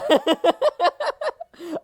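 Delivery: high burst of laughter; laughing. Real, not acted. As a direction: laugh out, pleased. Laughs at praise.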